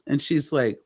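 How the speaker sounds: ordinary delivery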